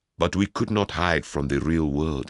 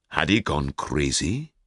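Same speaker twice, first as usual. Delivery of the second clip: usual speaking voice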